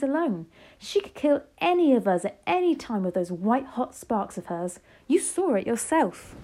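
lively read-aloud with character voices; story narration. A narrator's reading. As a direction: read it to the class with feeling. Reads novel aloud to class.

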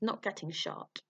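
ordinary delivery, nothing marked

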